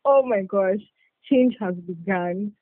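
usual speaking voice

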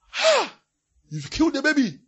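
a high loud gasp of horror; shocked crowd cry. Retold or imitated. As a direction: cry out as if they saw a killing.

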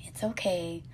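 hushed, gentle whisper, very soft; soothing voice. Retold or imitated. Mom soothing her upset son, soft whisper. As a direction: soothe a child in a breathy hush. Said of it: "tell him in a soothing voice".

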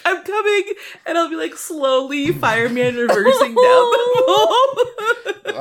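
high squeaky laughing voice. Real, not acted. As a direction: talk through laughter. Laughing through a silly image.

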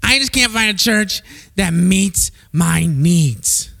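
slow complaining churchgoer voice; whiny churchgoer. Retold or imitated. Preacher mimics a picky churchgoer, slow and whiny. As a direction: mope about finding no church. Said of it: "I've heard this many".

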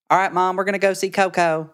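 high, pinched Southeast Texas twang; imitated niece and nephew in pinched Texas accent; pinched Southern accent. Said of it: "more pinched version of that".

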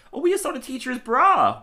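put-on high squeaky kid voice; high excited child. Kid voice in retold scene.

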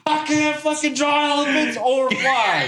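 mock panic. Tattooer falling through sky, mock panicked whine. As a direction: play a freaked-out tattooer falling.

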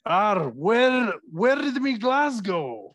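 slow, high Scottish pub voice; Scottish accent. Pretend. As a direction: say it like a Scotsman in a pub. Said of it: "in a Scottish accent".

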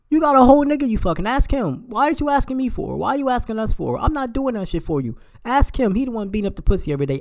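high, loud and fed up; exasperated rant. Real, not acted. Ranting at women asking for money.

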